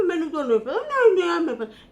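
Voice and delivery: high, laughing mock protest; mocking denial. Mocking Salma denying everything, high and laughing. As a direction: parody her playing innocent. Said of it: "उसने खामोशी थाम ली".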